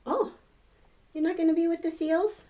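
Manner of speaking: plain reading voice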